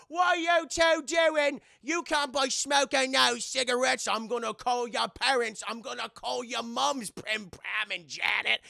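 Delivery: high, loud, slow mock Cockney scolding; scolding Cockney teacher. Teacher scolding the smoking schoolgirls, loud mock Cockney. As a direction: tell the girls off like a shrill Cockney teacher.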